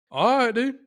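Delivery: high, loud, pumped-up quote; fired-up reply. Retold or imitated. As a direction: hype him up, go for it. Retelling his reply to eager friend.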